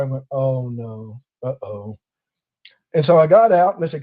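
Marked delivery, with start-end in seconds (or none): 0.3-2.0 soft, sinking uh-oh; dismayed oh no. Retold or imitated. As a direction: drop it low and soft in dread. Realizing trouble in retold story.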